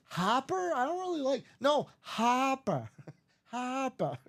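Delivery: mock Boston, stretching "no, Hopper"; Boston accent. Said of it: "he's like".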